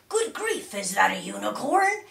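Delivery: gasping astonished driver; shrieked surprise. A narrator's reading. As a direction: shriek it like a startled truck driver. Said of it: "he shrieked in great surprise".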